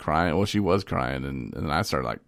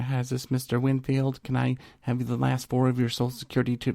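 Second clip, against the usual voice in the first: higher, put-on customer service voice; chirpy call-center rep voice, pitched up; mimicking phone agent; 6 semitones higher than usual. Phone agent asking his account number, in a lifted put-on voice. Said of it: "She'd be like".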